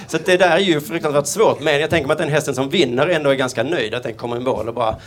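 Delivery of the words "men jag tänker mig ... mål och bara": ordinary talk, nothing marked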